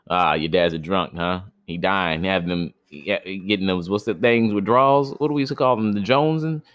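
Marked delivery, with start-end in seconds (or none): none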